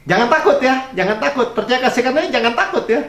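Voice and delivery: a preacher lifting the room: don't be afraid; preaching voice. Retold or imitated. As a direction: preach it up high to the crowd. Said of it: "kalau saya khotbah, saya bilang gini".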